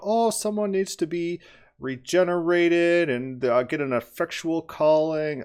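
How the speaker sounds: lightly hammed-up imitation of an opponent; mock theological quote. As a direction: parody someone reciting doctrinal jargon. Mockingly voicing the opposing theology.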